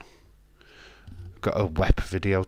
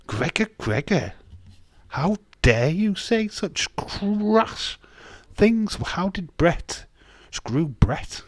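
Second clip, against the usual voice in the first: much higher, slow, hammed-up scandalised voice; mock outrage; 10 semitones higher, slower than usual. Streamer feigning shock at a viewer's crude comment, high mock-prim voice. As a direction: play a prim, scandalised gent clutching pearls.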